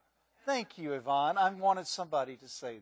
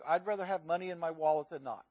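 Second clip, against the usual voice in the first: ordinary talk, nothing marked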